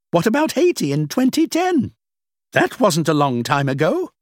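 a hint of a dismissive older woman; lightly played older woman, amused. The woman lists disasters.

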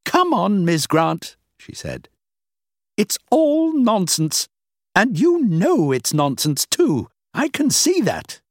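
a hint of a dismissive older woman; lightly played older woman, amused.

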